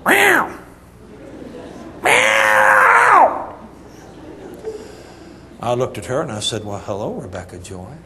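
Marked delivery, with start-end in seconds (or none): 0.1-3.5 loud, high imitated baby squall; mad newborn wail. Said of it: "She was mad". 6.6-7.9 low, soft hello to a newborn; tender greeting. Retold or imitated. Greeting his newborn daughter.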